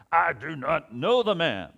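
slow, very loud shouted oath; swearing denial. Acted. Peter swearing to his accusers, furious shouted denial. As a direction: shout it high, hammering each word. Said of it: "began to invoke a curse on himself and to swear".

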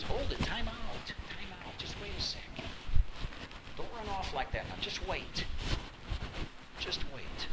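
soft, high urgent hush; kind, merciful restraint. Acted. The Lord stopping Cain from running off, kindly. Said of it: "in his kindness and his mercy says".

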